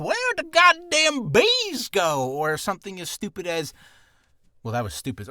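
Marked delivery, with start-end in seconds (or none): 0.0-2.3 put-on much higher and louder, slow cranky old-man yell; a shouting old codger up the street; high, loud rambling old man; crazy old guy. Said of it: "a crazy old guy up the street".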